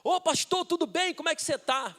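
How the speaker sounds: high-pitched mock receptionist hello; chirpy greeting. Gym receptionist hailing the pastor, high chirpy greeting. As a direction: call out a high, friendly greeting.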